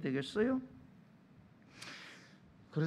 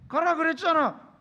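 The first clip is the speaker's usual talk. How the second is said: loud, high accusation; angry retort; 8 semitones higher, 9 dB louder than usual. Acted. Boyfriend arguing that she told him to go, angry retort. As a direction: throw the words back at the partner. Acted lovers' quarrel.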